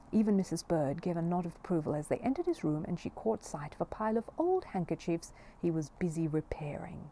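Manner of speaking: ordinary delivery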